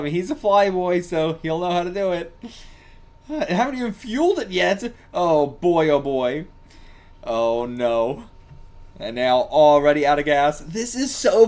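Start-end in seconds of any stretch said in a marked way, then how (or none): none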